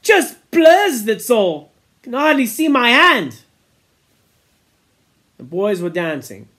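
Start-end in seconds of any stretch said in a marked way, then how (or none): 0.0-3.4 put-on higher, louder boy's line; higher-pitched boy's voice. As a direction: voice a tired boy squinting. A boy character speaking.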